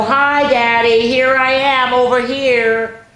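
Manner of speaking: put-on little girl's voice. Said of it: "because little Medi was sound asleep".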